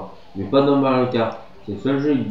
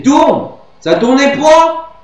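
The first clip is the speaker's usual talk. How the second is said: high, loud, slow frustrated shout; frustrated yell; 11 dB louder, slower than usual. Real, not acted. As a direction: yell at the kart to turn. Yelling at the game.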